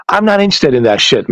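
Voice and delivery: usual speaking voice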